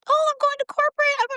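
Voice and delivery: high, bright and proud; young star telling her boss she's off to corporate, excited. Retold or imitated. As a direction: gush it with pitch way up. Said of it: "I was so excited".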